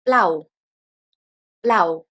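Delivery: blau with a hard b almost like p, falling; blau, blau, crisp German b; German pronunciation. Shown as a demonstration. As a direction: model blau twice for the class, falling tune. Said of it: "In German, we would say".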